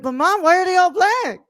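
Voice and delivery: put-on innocent little kid. Little boy asking his mom, high childlike voice. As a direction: play the clueless little kid tugging at mom.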